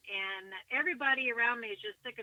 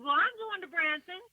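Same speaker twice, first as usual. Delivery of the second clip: high, raised, defiant call; defiant yell; 7 semitones higher, 5 dB louder than usual. Retold or imitated. Shouting back at the dismissive Branson lady, defiant yell. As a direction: throw it back at her in defiance. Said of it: "So I just yelled in defiance".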